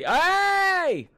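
sharp high yell of hey; sudden shout. Real, not acted. Gamer yelling at something on screen, sudden loud high shout. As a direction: blurt it out loud and high, startled.

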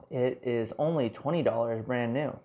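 ordinary delivery

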